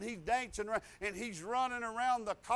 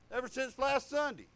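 ordinary talk

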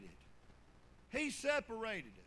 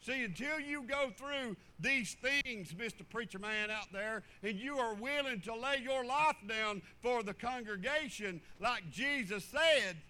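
loud preacher's cry building to a shout; fiery pulpit preaching ending in a shout; preaching at full force; 2 semitones higher, 5 dB louder than usual. Real, not acted. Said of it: "I gotta quit preaching like that".

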